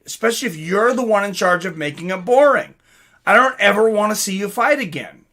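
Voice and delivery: ordinary talk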